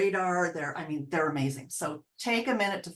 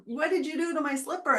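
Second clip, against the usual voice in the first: higher, scolding the dog; mock angry owner; 7 semitones higher than usual. Owner confronting the dog, higher mock scolding. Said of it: "And then you go".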